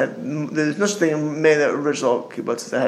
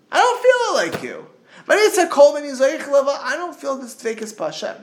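the wise son at the Seder asks, high and yearning; yearning wise child; 6 semitones higher than usual. Retold or imitated. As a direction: plead like a child who wants to feel it. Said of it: "And he's saying".

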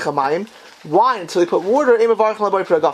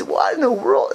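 higher pitched, baffled what in the world; astonished Tosfos; 6 semitones higher than usual. Acted. Teacher acting out Tosfos's question, baffled. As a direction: play Tosfos dumbfounded by the Gemara. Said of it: "Tema means".

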